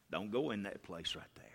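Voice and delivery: soft unvoiced whisper; hushed whispered caution; whispered warning. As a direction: breathe the warning gently. Holy Spirit whispering warning.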